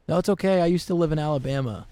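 imitated mic announcement. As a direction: call it back over the mic. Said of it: "I actually said this in the mic".

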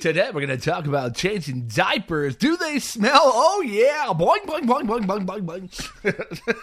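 high, hammed-up morning show patter; loud cartoonish mock broadcast; zany radio host. Mock baby-talk radio show.

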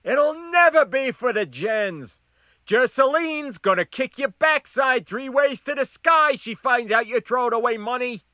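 scolding shout. Acted. Crisper warns cook about bet, high shout. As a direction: berate loudly from the counter.

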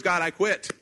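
mock resignation, addressed upward; fed-up resignation. Prophet telling God he quits, fed up.

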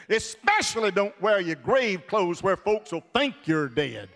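ordinary talk, nothing marked

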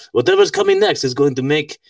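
put-on makeshift Russian-style accent; deliberate, vaguely Russian accented quote; rough Russian accent. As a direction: do Klaus Schwab in a loose Russian accent. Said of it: "I said that's kind of a Russian accent".

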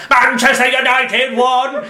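football result in a high, slow mechanical shout; Dalek. Retold or imitated. Super fan reading football results in a pub, shouted Dalek voice. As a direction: bellow the score like a robot. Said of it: "just screaming a Dalek voice".

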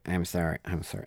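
soft, cowed sorry; scared students apologizing, timid; frightened apology. Retold or imitated. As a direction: shrink and apologize quietly. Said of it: "like a frightened turtle".

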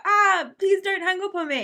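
high, loud pleading cry; begging a shouting customer, high and loud. Retold or imitated. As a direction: beg him not to hang up, desperate. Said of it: "I was just like".

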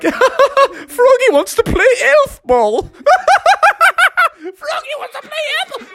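high, laughing taunt; mocking laughter. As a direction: jeer at him through laughter. Kids mock Froggy laughing.

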